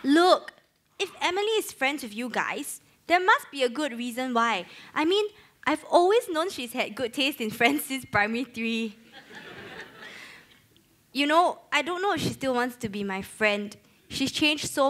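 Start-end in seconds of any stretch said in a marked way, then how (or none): none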